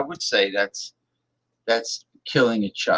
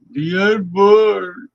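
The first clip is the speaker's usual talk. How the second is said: high, loud, slow mock cry; loud drawn-out mock wail; mocking old zealot; 8 semitones higher, 8 dB louder, much slower than usual. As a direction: ham up the pious old extremist. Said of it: "he used to say".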